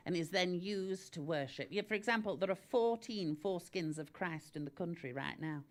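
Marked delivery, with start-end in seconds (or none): none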